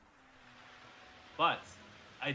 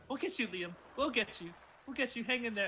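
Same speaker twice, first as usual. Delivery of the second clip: encouraging soldiers; 8 semitones higher than usual. Acted.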